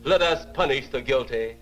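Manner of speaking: ordinary talk, nothing marked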